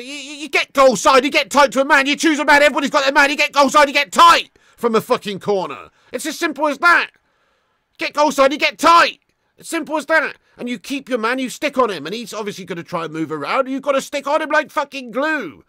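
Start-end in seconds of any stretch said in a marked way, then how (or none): 0.0-12.0 loud, high-pitched angry insistence; exasperated rant. Real, not acted. Ranting about how to mark. 13.7-15.6 high, heated raised voice; exasperated insistence. Real, not acted. Ranting about how to mark.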